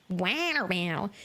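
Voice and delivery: "Water battle" with flapped t's, "wanner bannel"; mock overcorrected American accent. Streamer mimics a Brit overcorrecting to American, mock "Water battle". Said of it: "water bottle in an American accent".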